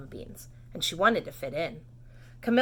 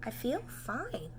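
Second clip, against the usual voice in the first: soft reply; 3 semitones higher, 10 dB quieter, much slower than usual. Acted. Sick girl calmly answers.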